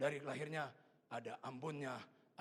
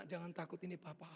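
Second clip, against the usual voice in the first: compassionate reassurance. Retold or imitated. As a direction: soothe the frightened child gently. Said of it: "Rasa iba melingkupi saya".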